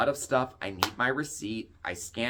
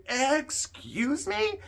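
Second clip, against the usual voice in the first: much higher, slow, drawn-out "Excuse me?"; indignant; much slower than usual. Retold or imitated. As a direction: stretch it out in offended disbelief. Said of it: "I was so mad".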